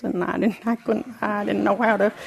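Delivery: put-on Texas twang, pitch lifting; imitated Texas twang. Newcomer imitating the Texans she barely understood, Texas twang. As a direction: mimic a baffling Texas twang, run together. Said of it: "had a twang to it".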